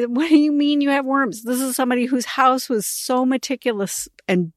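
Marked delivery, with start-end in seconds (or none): 0.0-1.4 higher, disbelieving "What do you mean"; incredulous question. Retold or imitated. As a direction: ask as if you can't believe it.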